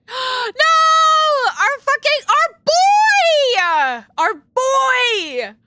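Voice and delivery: high, loud anguished wail; anguished shout. Real, not acted. Gamer sees their favourite character dead, anguished shout. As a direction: cry out in grief for him.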